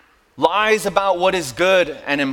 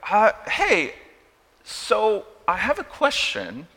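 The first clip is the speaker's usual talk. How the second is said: awkward hesitant greeting; 3 semitones higher than usual. Acted. Friend sheepishly approaching with a question, hesitant. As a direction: hesitate as if nervous to ask. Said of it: "do it in a different way".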